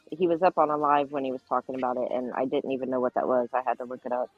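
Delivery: normal talking voice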